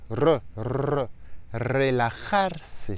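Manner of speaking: re, re then relajarse with a trilled start; rolled initial R. Shown as a demonstration. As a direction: roll the r before relajarse. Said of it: "le R en tout début de mot est double".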